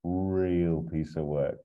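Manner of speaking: slow, relishing "Real", its first sound stretched; rolled words. Acted. Marcella sums up the villain for her boss, rolling "Real" slowly. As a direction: draw out "Real" with a long rolled R. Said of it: "she always rolls her words".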